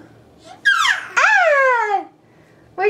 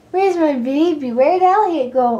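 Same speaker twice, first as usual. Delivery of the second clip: ordinary talk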